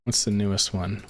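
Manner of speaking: normal talking voice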